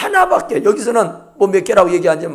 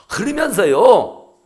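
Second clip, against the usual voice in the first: ordinary talk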